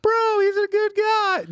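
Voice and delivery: high, slow pleading bro voice; hammed-up imitation of a defensive manager; mock club manager. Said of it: "the general manager of this club".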